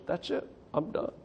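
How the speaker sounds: breathy whisper, giving up; defeated whisper. Retold or imitated. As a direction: let the words die out, beaten. Giving up in despair.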